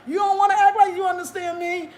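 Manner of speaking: hammed-up auntie telling her off; mock auntie shout. Imitating the auntie yelling that she pretends not to understand, loud and high. Auntie scolding over bananas.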